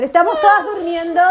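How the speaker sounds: normal talking voice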